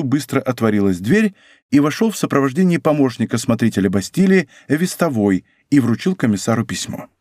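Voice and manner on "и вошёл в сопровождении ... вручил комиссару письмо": usual speaking voice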